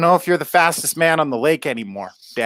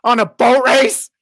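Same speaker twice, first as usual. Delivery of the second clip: shocked; 8 semitones higher, 5 dB louder than usual. Acted. Son shocked by the wager.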